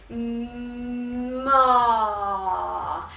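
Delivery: long hum opening into loud ah; hum, then release the vowel; chest voice. Shown as a demonstration. Chest warm-up, hum swelling to open vowel. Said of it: "this is our chest voice".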